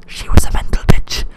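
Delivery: breathy whispered aside; conspiratorial whispered jab; stage whisper. Acted. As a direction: whisper it like a guilty secret. Sly aside about dead girlfriend.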